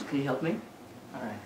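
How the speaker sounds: usual speaking voice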